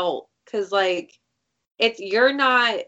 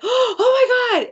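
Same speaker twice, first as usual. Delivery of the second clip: high, slow, gasping oh my God; shocked gasp; 5 dB louder, slower than usual. Retold or imitated. Watching the show, gasping at a twist.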